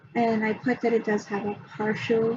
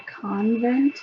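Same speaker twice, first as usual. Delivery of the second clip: "convent" split into CON and VENT, both stressed; separated, stressed syllables. Retold or imitated. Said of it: "separated and really stressed the syllables".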